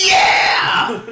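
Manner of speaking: high, loud mock cheer; hammed-up crowd roar; overexcited crowd. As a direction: yell it with absurd excitement. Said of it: "Nobody is that excited ever".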